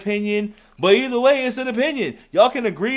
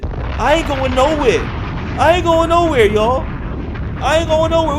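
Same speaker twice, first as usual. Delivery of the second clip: loud, high, defiant; defiant shout; 12 semitones higher, 6 dB louder than usual. Real, not acted. Reactor shouting defiance at his unsubscribers, loud and high. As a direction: plant your feet and shout it.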